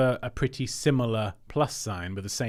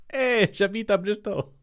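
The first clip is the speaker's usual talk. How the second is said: higher, hammed-up French accent with a loud Hey; cartoon French accent, higher and louder; put-on French accent; 11 semitones higher, 5 dB louder than usual. Shown as a demonstration. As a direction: overdo a jokey French accent. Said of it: "I don't know what that accent was meant to be".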